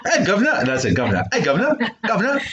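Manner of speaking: high, hammy fake British accent; hammed-up British accent. Impersonating an English coworker for the co-host, hammy British accent. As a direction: ham up a cockney-ish British voice. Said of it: "fuck up your accents".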